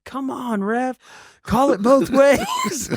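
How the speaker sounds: high, drawn-out protest from the stands; imitated indignant fan holler; retold shouting at referee. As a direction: heckle the ref in a mock yell. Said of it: "and I'm shouting".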